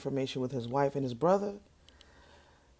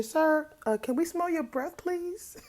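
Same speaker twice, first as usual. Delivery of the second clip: much higher, prissy polite reporter voice; mock reporter question; 14 semitones higher than usual. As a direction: play a fussy reporter asking politely. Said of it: "Would you say".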